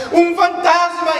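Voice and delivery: high, loud, slow scream of fright; terrified cry. Acted. Frightened disciples shouting at a figure on the water. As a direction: cry out at a ghost.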